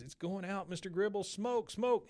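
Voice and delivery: lightly voiced panicked boy; Bobby urging. Bobby urging Mister Gribble to smoke, mildly panicked. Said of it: "Bobby says".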